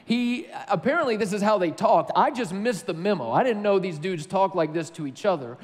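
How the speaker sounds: ordinary talk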